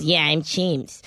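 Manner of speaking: slow, drawn-out cat voice. Retold or imitated. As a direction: stretch it out like a lazy cat. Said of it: "That's what he sounds like".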